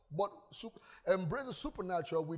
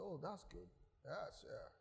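subdued reply; 2 semitones lower, 17 dB quieter than usual. Retold or imitated. Humbled teacher's reply imitated.

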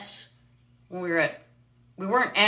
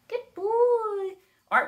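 high-pitched bird talk; 4 dB louder, much slower than usual. Real, not acted. Owner greeting her parrot Freedom in high-pitched coo. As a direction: coo up high like talking to a pet. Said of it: "He does enjoy a high-pitched voice".

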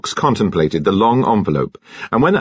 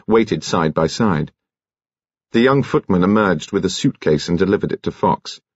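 usual speaking voice

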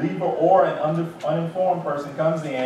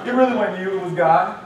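normal talking voice

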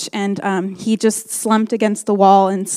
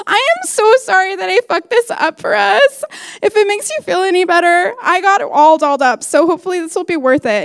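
high gushing apology; 8 semitones higher than usual. Retold or imitated. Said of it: "I'd be like".

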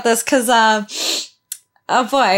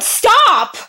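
upset yell; 10 semitones higher, much slower than usual. Real, not acted. Upset fan yelling at screen.

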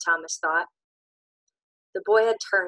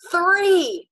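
high, loud final count; shout; 11 semitones higher, 9 dB louder than usual. Acted. Albie shouts the last count before shooting Ben, loud shout. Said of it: "Albie shouted".

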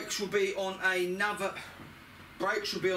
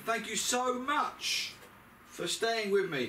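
drawn-out thanks; 7 semitones higher, slower than usual. Real, not acted. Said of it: "thanking viewers".

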